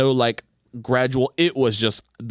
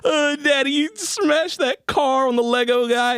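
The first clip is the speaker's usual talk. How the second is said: put-on high, giggly little-kid voice; imitating a giggling toddler. Dad imitates his laughing son praising his car smash, high and giggly. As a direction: squeal it like a delighted small child. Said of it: "And he was like".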